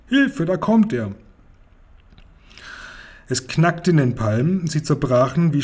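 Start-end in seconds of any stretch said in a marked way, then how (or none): 0.1-1.1 high-pitched storybook cry for help; mock-frightened, high call; alarmed cry. A narrator's reading. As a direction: leap up in pitch as danger appears. Girl sees pursuing whale coming.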